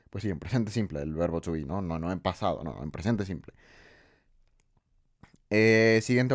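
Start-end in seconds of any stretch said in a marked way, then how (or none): 0.1-3.5 quiet aside. Real, not acted. Quiet self-correction aside.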